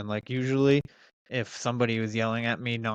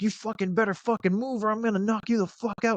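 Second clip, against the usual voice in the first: much higher, angry yelled threat; retold furious threat; 10 semitones higher than usual. Speaker threatening the driver blocking traffic, angry yell. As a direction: bark it out like you're ready to fight. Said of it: "I was like".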